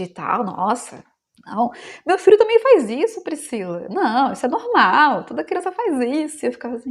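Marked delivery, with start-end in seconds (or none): 2.1-6.4 imitating a breezy know-it-all, pitch leaping high; mock dismissive parent. Other parent waving away Priscila's worry about her child, mock dismissive. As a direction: brush it off in a swooping, higher voice.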